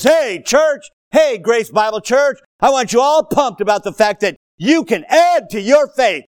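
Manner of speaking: high, loud, calling out; rally the church; rousing shout. Acted. Peter's message voiced to the church, loud shout. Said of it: "Spirit of God through Peter says".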